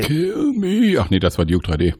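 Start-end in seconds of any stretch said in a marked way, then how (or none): 0.0-1.0 high pleading quote. Retold or imitated. Quotes film line.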